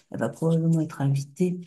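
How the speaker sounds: ordinary talk, nothing marked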